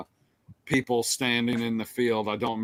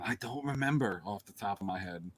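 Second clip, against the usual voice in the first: usual speaking voice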